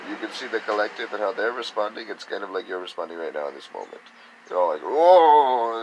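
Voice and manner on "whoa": loud, high mock gasp of surprise; a big startled whoa, as if hit by a wave; overwhelmed exclamation. Mimics overwhelmed people reacting.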